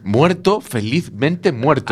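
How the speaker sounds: drawn out slowly, savouring every word; slow relish. Acted. Joking about dying happy, stretching the phrase slowly.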